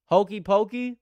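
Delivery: high, loud disbelieving read of Hokey pokey; incredulous. Real, not acted. Streamer reading a goofy flavor name off the list, loud and incredulous. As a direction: blurt out Hokey pokey like it can't be real. Reads silly flavor, then repeats plainly.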